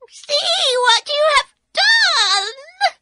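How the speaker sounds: very high, loud slow scream; screaming witch. Acted. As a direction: shriek in horror as she melts. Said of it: "she screamed".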